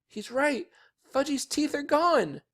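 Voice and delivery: put-on high, dismayed little-girl voice; screamed. A narrator's reading. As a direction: wail the bad news up high. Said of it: "Sheila screamed".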